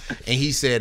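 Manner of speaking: ordinary talk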